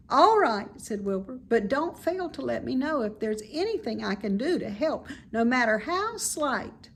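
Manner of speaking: put-on bright childlike pig, fond of the barn; high, eager young pig; young pig Wilbur. Wilbur voiced higher.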